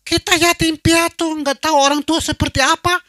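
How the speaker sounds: very high, loud impassioned cry; fervent preaching. Real, not acted. Preacher cries out to the orphaned couple's story, fervently. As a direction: preach it with full fervour.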